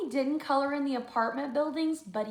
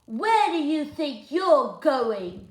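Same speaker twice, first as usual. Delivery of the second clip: loud, slow, higher demand; a stern little gatekeeper; challenging George; 4 semitones higher, 9 dB louder, slower than usual. Acted. George challenging Luther, loud and slow.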